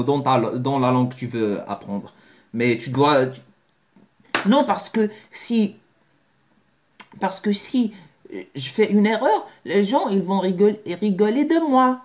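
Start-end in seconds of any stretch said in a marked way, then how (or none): 4.5-12.0 high, whiny fretting over mistakes; lightly voiced anxious beginner; mock worried learner. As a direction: fake nervous excuse. Imagined fearful learner's excuse.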